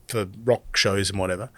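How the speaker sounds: normal talking voice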